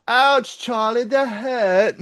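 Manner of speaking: put-on high, slow British child whine; imitating a hurt British toddler; British accent. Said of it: "I'm still doing the British accent".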